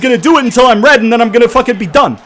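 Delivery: loud, high-pitched real rant; frustrated yelling. Real, not acted. Gamer raging at what the AI does, frustrated yelling. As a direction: yell it out, utterly exasperated. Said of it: "It's just frustration".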